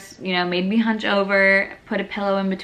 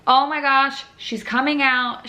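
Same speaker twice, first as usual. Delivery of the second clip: alarmed realization; 6 semitones higher than usual. Retold or imitated. Realizing the baby is crowning.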